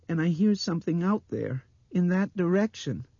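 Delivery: put-on very high, loud child's voice; insistent little girl. A narrator's reading. Blind girl warning of a sound outside, insistent. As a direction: press it loudly, high as a child.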